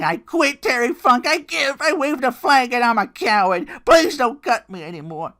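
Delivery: loud, high pleading cry; desperate high yelled surrender; begging surrender. Retold or imitated. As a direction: beg him to stop cutting. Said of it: "I wanted to say".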